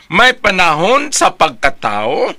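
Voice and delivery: usual speaking voice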